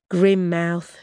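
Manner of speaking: ordinary delivery, nothing marked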